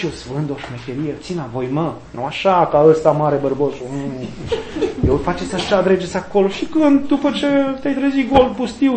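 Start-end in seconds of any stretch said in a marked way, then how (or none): 0.0-6.5 sly devil. Pretend.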